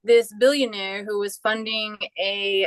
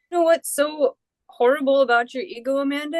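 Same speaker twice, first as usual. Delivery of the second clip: billionaire friend; 5 semitones higher than usual. Retold or imitated. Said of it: "And he says".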